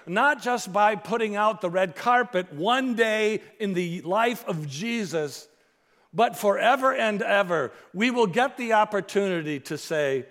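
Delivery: loud, high, ringing pulpit voice; fiery sermon climax; full-force preaching. Acted. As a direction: preach it out to the whole room.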